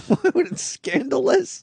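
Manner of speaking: high, drawn-out teasing question; mock-scandalized. Co-host teasing a friend over his word, mock-scandalized. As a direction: stretch it out high, mocking the word.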